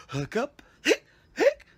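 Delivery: forced squeaky hic sounds; fake hiccups. Shown as a demonstration. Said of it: "I was gonna show you my horrible hiccups".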